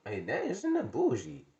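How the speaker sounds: usual speaking voice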